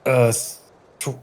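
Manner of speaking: ordinary talk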